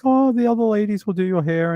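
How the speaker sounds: high, quick retold reassurance; imitating hairdresser. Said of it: "she's like".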